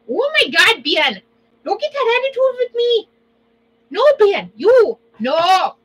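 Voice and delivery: high, loud hammed-up nagging; loud, high mock scolding. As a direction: scold Ben like an exasperated mom.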